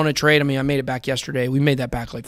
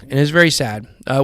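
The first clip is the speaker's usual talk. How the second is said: normal talking voice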